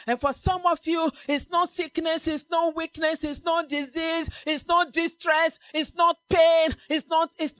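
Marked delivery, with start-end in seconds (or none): none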